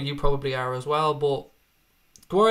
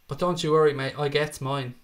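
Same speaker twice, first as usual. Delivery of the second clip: normal talking voice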